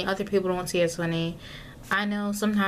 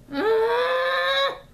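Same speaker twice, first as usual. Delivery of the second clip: sharp shriek pitched much higher; high frustrated shriek; 4 dB louder than usual. Real, not acted.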